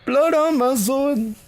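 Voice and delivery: drawn-out high-pitched echo; mock-dramatic falsetto; slow falsetto. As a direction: stretch it out in a squeaky high voice. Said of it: "The blood is on your sword".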